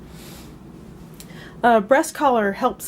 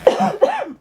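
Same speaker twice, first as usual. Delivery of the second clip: sudden real cough; a dry cough fit. Real, not acted. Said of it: "Excuse me, I'm coughing again".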